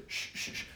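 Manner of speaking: sharp breathy shh shh shh; scornful crowd shushing the blind beggar. Retold or imitated. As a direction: hush him like an annoyed crowd. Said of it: "And the crowd said".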